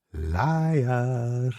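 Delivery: one high, distant word; inner accusation. A narrator's reading. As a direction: say it like a voice inside his head.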